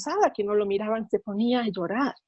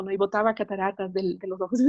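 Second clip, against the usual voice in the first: ordinary talk, nothing marked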